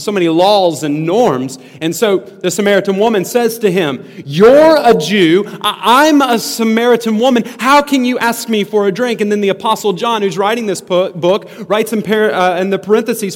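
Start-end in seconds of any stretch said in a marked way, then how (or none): 4.2-9.2 high, loud, incredulous questioning; raised pitch, stunned protest; shocked disbelief. Acted. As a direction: act out a woman taken aback by the request. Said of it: "she responds in shock about why he would talk to her".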